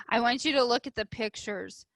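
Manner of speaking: ordinary delivery, nothing marked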